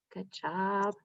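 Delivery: normal talking voice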